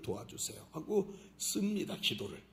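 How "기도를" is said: normal talking voice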